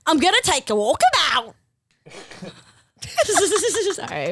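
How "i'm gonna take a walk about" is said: comic broad Australian voice; hammed-up Australian announcement; Australian accent. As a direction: put on a mock Australian accent. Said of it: "Australian accents?".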